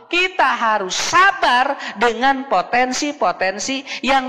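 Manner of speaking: high, loud sermon passion; fired-up preacher urging patience; preaching at full force. Acted. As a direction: preach it hard to the mothers. Preaching raised on parenting.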